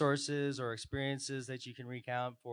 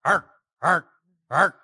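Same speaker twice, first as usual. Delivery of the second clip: lightly growled pirate "Arr"; pirate dog growl; 5 semitones higher, 15 dB louder than usual. Pretend. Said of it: "here's my pirate dog for Ted".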